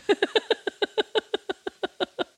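real high-pitched run of laughter. Real, not acted. Laughing at her own text about her nemesis, high giggle. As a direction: crack up at your own pettiness.

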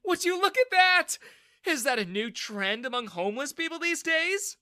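high, loud mocking sneer; sneering Daniel. A narrator's reading. Bully father mocks the soaked young man, sneering loudly. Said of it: "Daniel sneered".

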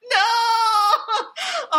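high wailing no; dismayed cry. Retold or imitated. Réaction au centimètre. (non aigu et désespéré)

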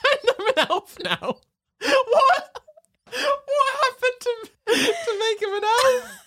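very high, crying with laughter; laughing elf voice. Retold or imitated. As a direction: squeak through tears of laughter. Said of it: "mimics elf voice".

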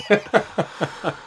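a sudden high burst of real laughter. Real, not acted. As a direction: crack up at the thought. Laughing at his own fumble worry.

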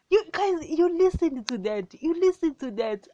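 high, loud urging to listen; urgent excitement. Real, not acted. Preacher to his listeners, high and loud excited urging.

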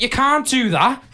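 high, loud, slow scolding squeal; imitating Jake. Jake telling Cal off in the van, high mock scolding. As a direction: squeal it like a panicked driving instructor. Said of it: "He went".

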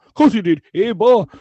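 high, loud mock protest; mocking the pedants. Fan imitates politically correct objectors, high mocking protest. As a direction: raise the pitch and mimic an indignant objector. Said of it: "all the political correct ones will go".

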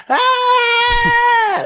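high yelling charge scream; charging henchman's shrill yell; battle cry. Acted. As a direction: let out a wild high war cry. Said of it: "then Charlie goes".

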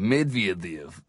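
deep, soft Russian accent. Shown as a demonstration. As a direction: drop the voice low into a mock Russian. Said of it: "put on the Russian accent".

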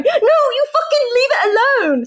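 high, indignant outburst; hammed-up fury, pitch soaring; mock outraged yell. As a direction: explode at the kid in a high voice. Acted outburst at the imagined child.

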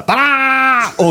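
high, drawn-out fanfare; comic soaring sound effect; wasp taking off. Retold or imitated. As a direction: imitate a wasp shooting up. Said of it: "då flyger den upp så här".

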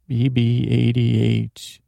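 ordinary talk, nothing marked

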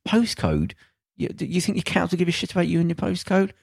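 mock the word, then ask it up high; scornful question.

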